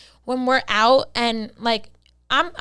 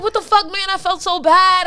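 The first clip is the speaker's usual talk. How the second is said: high, loud exasperated relief; relieved guy; 7 semitones higher, 6 dB louder than usual. Retold or imitated. Guy realizing the fall was fake, loud exasperated. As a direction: play the guy caught by the prank. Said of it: "he's like".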